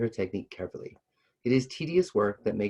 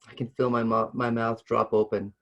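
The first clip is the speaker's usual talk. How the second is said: plain reading voice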